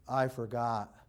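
low, soft mock excuse; forgetful teenager. Teenager questioned about undone chores, low feeble excuse. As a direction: drop right down into a dopey excuse.

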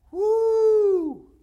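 long, high, loud woo; joyful pulpit whoop; exultant whoop. Real, not acted. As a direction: let out a sustained cry of elation. Preacher whoops after climax.

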